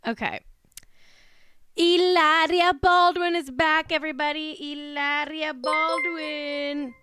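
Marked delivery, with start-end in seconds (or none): 1.8-6.9 drawn-out, raised proclamation; hyped announcement. Acted. Host heralds their queen's comeback, hyped announcement. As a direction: announce her with long, ringing vowels.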